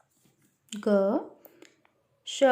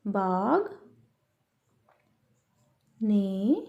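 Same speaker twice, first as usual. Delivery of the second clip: one word at a time with long pauses, softer; teacher dictating the corrected sentence word by word; slow dictation; 4 semitones lower, 4 dB quieter, much slower than usual. Shown as a demonstration. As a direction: dictate slowly to children writing along. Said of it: "तो हम यहाँ पर क्या लिखेंगे".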